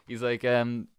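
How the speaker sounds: normal talking voice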